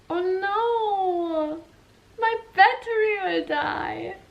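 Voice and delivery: high, whimpering mock panic. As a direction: wail that the battery is dying. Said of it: "I was like".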